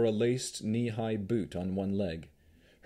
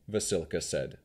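ordinary delivery

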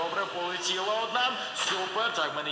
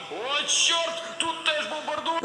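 high startled cry; pitched-up game alarm; alarmed surprise; 10 semitones higher, slower than usual. Acted. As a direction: gasp it out as bombs fall again. Bombing again in game.

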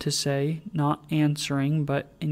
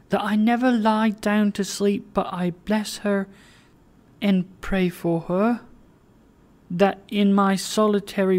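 urgent tender plea; 5 semitones higher, 3 dB louder than usual. Acted. Meg's tender message for Lilian.

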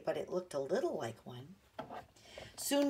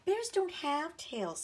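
put-on older sister voice; 10 semitones higher, slower than usual. A narrator's reading. Jessie voiced by narrator.

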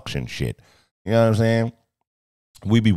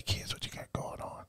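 whispering; 18 dB quieter than usual. Real, not acted. Whispered complaint.

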